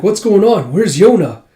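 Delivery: high, loud urgent questions; alarmed questions. Acted. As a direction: demand answers in panic. Alarmed companion asks.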